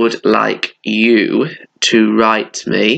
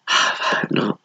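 sighing; 6 dB quieter than usual. Real, not acted.

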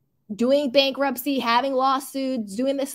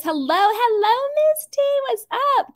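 playful high greeting; 12 semitones higher, 4 dB louder than usual. Pretend. Reads a fan greeting playfully.

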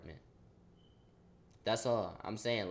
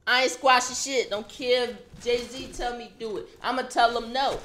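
higher, louder defiant declaration; refusing to squash the beef, loud and defiant; 10 semitones higher, 7 dB louder than usual. Real, not acted. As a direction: refuse loudly, heated. Refusing to make peace.

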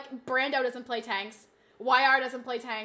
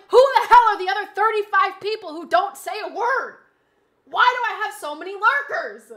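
loud, high, baffled outburst; exasperated shouted question; exasperated yelling; 5 semitones higher, 12 dB louder than usual. Acted. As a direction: yell it in mock outrage at the lurkers. Exasperated rant about silent viewers.

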